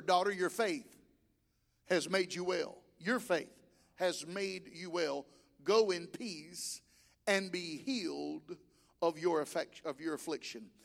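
gentle reassuring words to the healed woman; tender Jesus. Acted.

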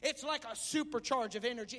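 ordinary talk

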